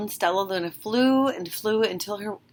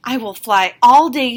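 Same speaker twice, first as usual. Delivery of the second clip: determined; 4 semitones higher, 10 dB louder than usual. Acted. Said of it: "told herself".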